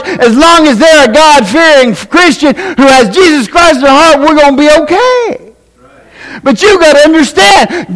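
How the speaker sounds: high-pitched, emphatic sermon delivery; fired-up preaching. Real, not acted. Preacher telling the congregation God is still God in hard times, fired-up preaching. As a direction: preach it hard, stretching the big phrases.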